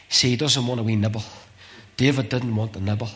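normal talking voice

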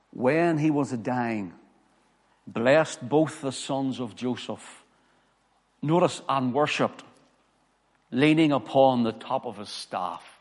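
slow, loud, solemn reading of scripture; grave pulpit reading. Acted. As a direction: proclaim the verse slowly from the pulpit. Preacher reads scripture aloud.

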